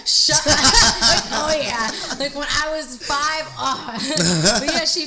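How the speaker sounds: loud laughter through words; laughing. Real, not acted. Recalling her old teacher, laughing loudly. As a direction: laugh while trying to speak.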